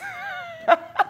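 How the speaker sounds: high shrieking cackle; shrieking laugh. Real, not acted. Laughing at her own troll post, a high shriek of laughter. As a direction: burst out in a squealing laugh.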